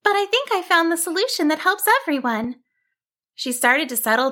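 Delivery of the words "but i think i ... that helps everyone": high, quick sheep voice; high-pitched, brisk self-encouragement; hopeful sheep heroine. Acted. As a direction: think aloud in a high little voice. Sheep heroine talks to herself.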